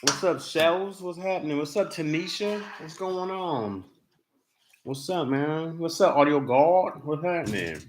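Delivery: talking while crunching chips. Real, not acted. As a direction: mouth full of chips. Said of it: "Sorry about my crunching".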